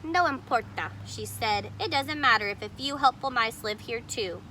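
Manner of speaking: put-on lively read-aloud voice for children; storybook reading. A narrator's reading. Teacher reading a picture book to kids, animated. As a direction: read the story to the class with expression.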